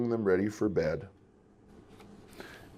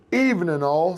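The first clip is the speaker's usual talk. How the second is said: hearty greeting, a bit higher and louder; big cheerful sitter's welcome; loud, jolly voice; 8 semitones higher, 5 dB louder than usual. A narrator's reading. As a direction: boom a jolly hello to the family. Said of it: "in a loud, jolly voice".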